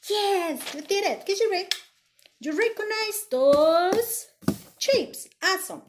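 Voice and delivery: high, loud cheering; excited praise. Real, not acted. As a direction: celebrate their answer. Teacher praising kids' answers.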